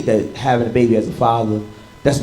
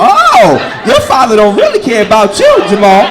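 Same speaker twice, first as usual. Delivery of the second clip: mock teacher taunt; 7 semitones higher, 7 dB louder than usual. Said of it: "they pick on your child".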